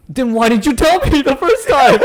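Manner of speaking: high-pitched, laughing exasperation; exasperated retort. Retold or imitated. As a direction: retell it as an incredulous, squeaky protest. Acted retort to mistaken customer.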